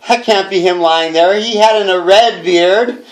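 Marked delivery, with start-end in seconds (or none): none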